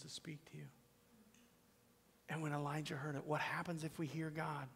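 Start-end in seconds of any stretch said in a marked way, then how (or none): 0.0-0.7 hushed, intimate whisper. Real, not acted. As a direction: lean in and whisper it. Pastor whispers.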